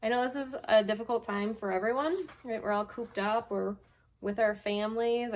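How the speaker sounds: plain reading voice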